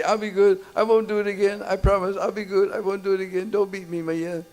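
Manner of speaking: put-on high, tearful little boy begging forgiveness; crying child pleading. Little Krishna pleads with his mother, voice high and tearful. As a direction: beg like a small child caught and scared. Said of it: "And he's crying".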